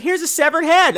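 high, singsong daughter voice; high-pitched gift-giving daughter; sweet daughter. Acted. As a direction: present it brightly in a high voice. Voices daughter showing gifts.

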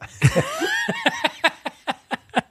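real laugh at own joke; high burst of laughter. Real, not acted. Laughing at hangover smell.

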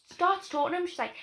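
ordinary talk, nothing marked

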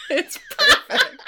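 high, slow, laughing swoon; giddy real laughter. Real, not acted. Admitting her favorite voice.

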